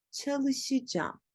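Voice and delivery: çalışacağım slowly as cha-lı-shı-jam; spoken contraction. Shown as a demonstration. Teacher repeats the word, çalışacağım as çalışıcam. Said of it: "Nasıl söyleyeceğim?".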